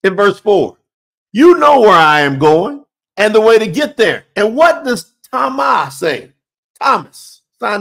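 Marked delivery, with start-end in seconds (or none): 1.3-4.2 You stressed, firm and pressed; emphatic quote. Acted. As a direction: drive home every word of the quote. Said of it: "look what he says there".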